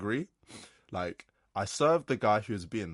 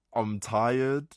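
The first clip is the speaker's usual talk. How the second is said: normal talking voice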